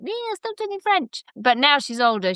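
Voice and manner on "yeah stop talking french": much higher, a mock little girl's complaint; imitating young daughter. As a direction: mimic her small daughter whining. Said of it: "she was like".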